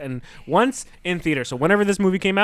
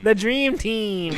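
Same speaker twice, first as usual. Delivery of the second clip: drawn-out hype chant; slow, drawn out; 5 semitones higher, much slower than usual. Pretend. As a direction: stretch every word slowly. Slow far drawl.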